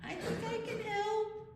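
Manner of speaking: small, high volunteer voice; Spider Grandma. Acted. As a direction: pipe up very high, stretching the words. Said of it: "she was like".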